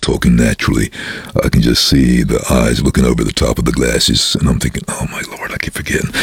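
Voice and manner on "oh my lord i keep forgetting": whispering. Real, not acted. Inner thought, unvoiced whisper.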